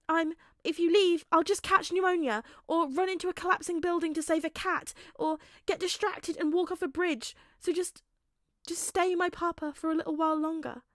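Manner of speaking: put-on high, loud tearful child voice; half-joking through tears; teary, pleading boy. A narrator's reading. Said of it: "tears in his eyes".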